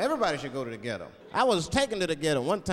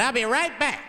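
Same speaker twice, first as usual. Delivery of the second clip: high, loud quick promise; urgent call-out; 8 semitones higher, 6 dB louder than usual. Retold or imitated. Limousine driver leaving his passenger, high loud call-out. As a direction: toss it out loudly on the way off.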